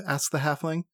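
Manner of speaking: ordinary delivery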